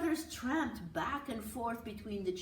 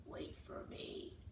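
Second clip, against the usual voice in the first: soft slow bear growl, barely voiced; growly bear voice; 10 dB quieter than usual. Little brother in bear suit lags behind, slow whispery growl. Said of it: "he said in a growly bear voice".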